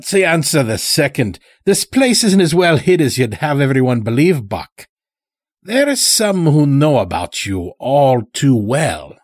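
put-on sly old-timer with an unplaceable accent; Morris's strange accent. A narrator's reading. Chained old stranger talking to the lodge master, in an odd accent. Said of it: "spoke in such a strange way".

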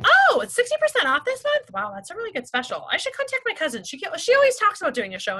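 high, bright mock surprise; imitating a consultant excited about the sale; surprised delight. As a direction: act out the consultant discovering a great deal. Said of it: "then they're like".

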